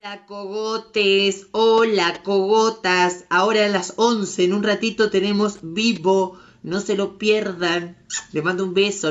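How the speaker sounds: loud, bubbly plug for tonight's live; excited promo announcement. Acted. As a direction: sell the live show with big energy.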